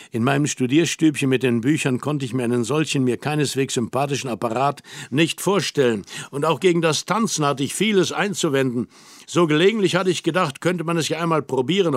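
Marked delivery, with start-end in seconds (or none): none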